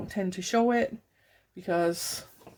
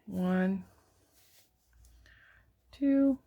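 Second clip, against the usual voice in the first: counting with a marker in the mouth; stitch marker in mouth; slower than usual. Real, not acted. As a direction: mouth half-blocked, words slightly muffled. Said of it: "I have a stitch marker in my mouth".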